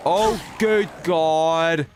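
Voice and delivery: long drawn-out groaning oh good God; groan of dread. Real, not acted. Gamer groans at a threat on screen, slow and loud. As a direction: dread it, stretch every word out. Reacting to danger in game.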